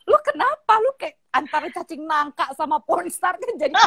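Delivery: high, incredulous imitating voice; mock baffled partner. Partner mocked for acting like a porn star, high incredulous voice. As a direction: ham up a baffled partner's reaction. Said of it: "it's gonna be like".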